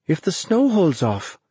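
high, hopeful eagerness; excited, lifted pitch; full of excitement. A narrator's reading. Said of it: "full of excitement".

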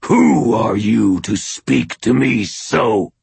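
slow, loud inhuman seethe; seething. A narrator's reading. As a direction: spit out each word with contempt. Skinwalker outraged at wizard.

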